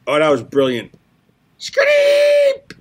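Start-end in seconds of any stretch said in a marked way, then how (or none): 1.6-2.7 shrill squeaky shriek sound; high shriek. Pretend. Reading fan's noise aloud.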